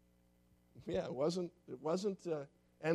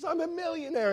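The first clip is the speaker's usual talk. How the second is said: much higher, squealing with joy; giddy delight; 12 semitones higher than usual. Acted. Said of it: "and she says".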